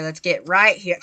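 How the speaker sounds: ordinary delivery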